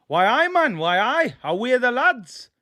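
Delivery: high, loud, hammed-up Geordie; mock Geordie accent. Southern streamer answering critics of his Newcastle accent, hammed-up Geordie. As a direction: belt out a bad Geordie impression, pitched right up. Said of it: "Come on, that's prime Geordie".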